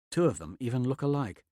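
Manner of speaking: plain reading voice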